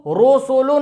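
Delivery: रोसोलो with open 'o' in place of 'u'; majhul, wrong way. Shown as a demonstration. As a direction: turn every vowel to 'o' in रोसोलो. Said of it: "ये गलत तरीका है". (रोसोलो, मजहूल आवाज़ में)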